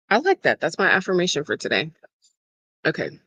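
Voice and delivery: ordinary delivery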